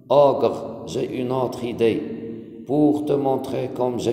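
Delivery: expressive classroom reading with pauses between lines; poem reading. Acted. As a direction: read it out to a class, line by line. Reads the poem aloud again.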